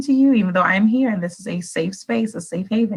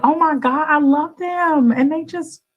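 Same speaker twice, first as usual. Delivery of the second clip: God voiced adoring; 8 semitones higher than usual. God gushing over his people, high and adoring. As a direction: coo it up high with love. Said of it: "just be like".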